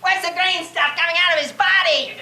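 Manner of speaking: put-on high, delighted little-boy voice; excited little boy. Little boy examining the butterfly he caught, gleeful fascination.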